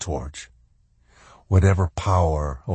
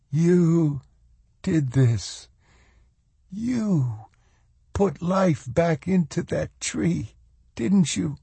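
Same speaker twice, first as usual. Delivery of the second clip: struggling to speak; 10 semitones higher, slower than usual. A narrator's reading. Carla confronting Swan about the tree, halting and overcome. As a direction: pause between words as if struggling to find them. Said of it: "Carla was trying to speak, but was unable to summon the words".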